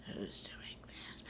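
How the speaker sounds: hissed unvoiced question; whispered conductor. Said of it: "he was like".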